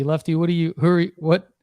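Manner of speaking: usual speaking voice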